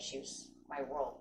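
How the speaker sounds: choked up, slow and soft; holding back tears over grandma; teary-eyed. Real, not acted. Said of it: "sorry, I'm getting teary-eyed".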